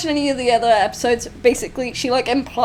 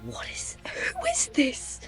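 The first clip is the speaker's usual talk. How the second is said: whispering; 3 semitones higher, 10 dB quieter, slower than usual. Real, not acted. Stunned by a strange new voice.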